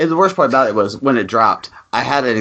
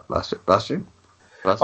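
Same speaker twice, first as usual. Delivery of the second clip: quiet, faraway calling of Buster; soft, distant imitation of friends calling Buster; soft calling of a name; 6 dB quieter, faster than usual. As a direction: call the name softly as if from afar. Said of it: "I heard you guys go".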